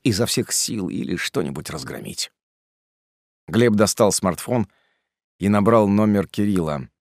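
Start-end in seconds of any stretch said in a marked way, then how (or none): none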